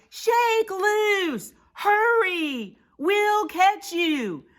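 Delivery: high, loud, drawn-out encouragement; urgent shout. Acted. Prairie dogs calling up to Big Bark, shouted. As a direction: holler instructions up high. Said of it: "the prairie dog shouted".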